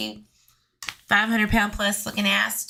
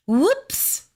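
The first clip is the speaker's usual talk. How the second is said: mock whoops; 10 semitones higher, 3 dB louder than usual. Mocking a forgotten gun holster.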